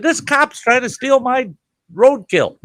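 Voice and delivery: tattle on the cop, outraged; indignant complaint. Retold or imitated. Complaining to the warden.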